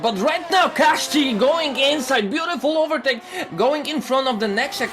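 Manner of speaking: real thrilled race call; excited shout. Real, not acted. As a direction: shout the overtake as it happens. Commentator calling a great overtake.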